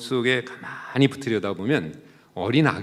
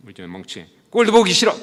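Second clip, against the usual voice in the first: scolding mother; 2 semitones higher, 5 dB louder, faster than usual. Acted. Mother yelling at her crying son, harsh and loud. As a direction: snap at the crying child in disgust. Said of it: "그렇게 한단 말이에요".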